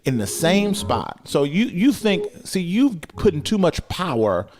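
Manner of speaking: high, loud preacher drive; full-force preaching. Real, not acted. Preacher urging the congregation to get still, full force. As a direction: whoop it up to the congregation.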